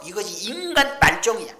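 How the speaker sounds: loud, high condemning cry; preaching outburst. Real, not acted. Preacher condemning ungrateful children, loud and high. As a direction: thunder it out at the sinners.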